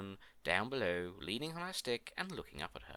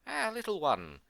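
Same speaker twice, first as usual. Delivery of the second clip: high, loud sigh falling steeply; rueful exclamation; 8 semitones higher, 8 dB louder than usual. A narrator's reading. Queen to the mouse she saved, ruefully exclaiming. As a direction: cry out ruefully to a little friend.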